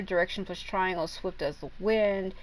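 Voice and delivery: normal talking voice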